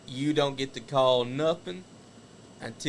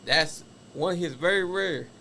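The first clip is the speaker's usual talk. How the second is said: excited live wrestling play-by-play; wrestling commentator; 2 semitones higher than usual. Acted. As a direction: shout the big moves, call the rest. Calls the wrestling match live.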